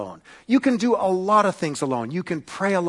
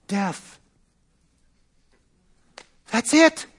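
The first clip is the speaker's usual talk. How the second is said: ordinary talk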